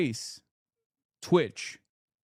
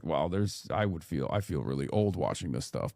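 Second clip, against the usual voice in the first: lower, softer weary mutter; feeling old; 6 semitones lower, 5 dB quieter than usual. Retold or imitated. Streamer reacting to young people online, low weary aside. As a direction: sigh it out like a tired old man. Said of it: "I was like".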